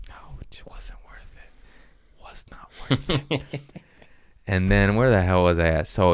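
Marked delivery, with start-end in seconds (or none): 0.1-3.4 very soft, slow pained whisper; mock pained whisper. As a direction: whisper it as if hiding the hurt. Hurt after catching, muttering to self.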